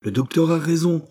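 a crowd voice shouting approval; crowd cry. Acted. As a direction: call it out as the crowd agrees. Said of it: "crie sans voix".

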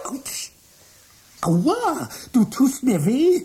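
high, yelping cries of pain. Acted. Michael shocked by his computer, high yelps of pain. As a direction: yelp as the current hits you.

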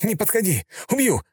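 high, trembling threat; shaky shout. A narrator's reading. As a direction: yell though terrified. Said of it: "крикнул он, голос дрожал".

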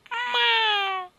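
high, loud mewing meow; sharp high cat cry. Acted. Cat meows in story.

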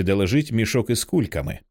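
usual speaking voice

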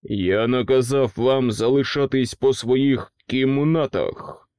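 slow, loud growled opener; king snaps. A narrator's reading. King turns on the principles, a loud slow snap. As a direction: bark at the principles. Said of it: "гаркнув він".

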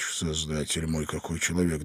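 whisper. A narrator's reading. Hiding man whispers in panic to the stranger beside him. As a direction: whisper under the bed in alarm. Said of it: "прошептал Иван Андреевич".

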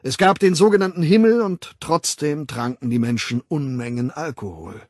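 emphatic public lecturer; lecturing World Controller. Acted. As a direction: hold forth with authority, punching key words. Weltaufsichtsrat doziert. (dozierend, nachdrücklich)

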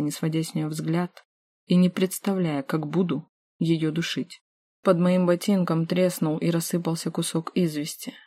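usual speaking voice

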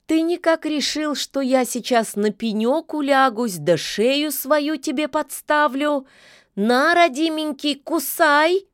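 high, sly mocking taunt; snide. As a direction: taunt him sweetly and snidely. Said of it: "ехидно уточнила".

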